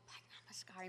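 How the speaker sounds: soft inner whisper; whispered in my head. Retold or imitated. Singer repeats the word silently in his head. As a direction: whisper it as a thought. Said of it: "It's like I'm going".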